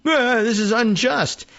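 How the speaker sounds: drawn-out, high mock protest; mock whiny complaint. Mocking the convicted guard's complaint, whiny and slow. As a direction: whine it like a sore loser, slowly.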